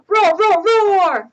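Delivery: lifted, quick triple play roar; victory roar. Acted. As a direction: roar in triumph like a dinosaur.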